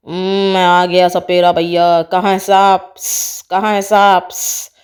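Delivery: very low voice ending in a hiss; deep snake-charmer voice with hissed tail; swaggering snake charmer. Acted. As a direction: announce yourself deep and theatrical, hissing. Snake charmer announces his arrival.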